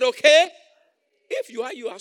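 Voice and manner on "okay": much louder, higher okay; loud repeat. Real, not acted. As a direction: shout it back at them. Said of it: "I said".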